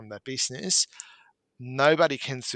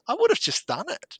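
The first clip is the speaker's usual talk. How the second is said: mock exasperated husband; 5 dB louder than usual. Husband defending himself to wife.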